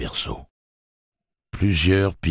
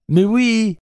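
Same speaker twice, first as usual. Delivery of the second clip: high, loud reply over the noise; excited high shout; shouting back; 7 dB louder than usual. Acted. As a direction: yell it back across the hall. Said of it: "cria Eddie en retour".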